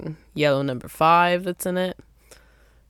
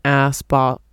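tongue-tied stumble; 2 semitones lower, 6 dB louder, much slower than usual. Real, not acted. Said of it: "I can't even say it".

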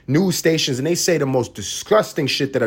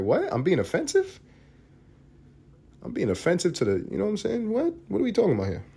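higher, softer baffled asking; mock confused big guy; 6 semitones higher, 5 dB quieter than usual. Vlogger voicing a puzzled big guy from Rikers, higher and baffled.

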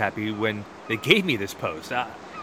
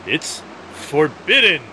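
louder and drawn out slowly, firm and final; insistent exclamation; 4 semitones higher, 6 dB louder, slower than usual. Acted. Guard telling the visitor the crossing is banned, slow loud insistence. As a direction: lay down the law, slow and loud.

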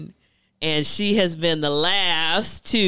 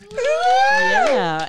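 high, loud excited yell; hype shout; 7 dB louder than usual. Real, not acted. Host hyping the crowd after the poem, loud yell.

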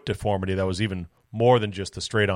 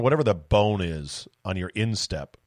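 ordinary talk

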